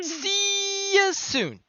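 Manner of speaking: sing-song goodbye. Acted. NPC signing off cheerily.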